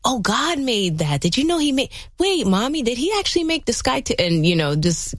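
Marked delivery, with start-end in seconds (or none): 0.0-1.9 parent's wonder. Acted. Parent telling child who made nature, acted wonder. 2.2-4.1 put-on high, quick child's question; lightly acted kid piping up to Mommy; curious little kid. Child asking mom about creation, high-pitched curiosity.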